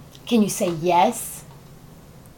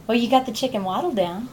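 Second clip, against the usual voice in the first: ordinary talk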